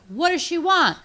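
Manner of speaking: high-pitched stranger passing it along; relayed movie question. Retold or imitated. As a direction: call it down the line, high-voiced. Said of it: "like a game of telephone".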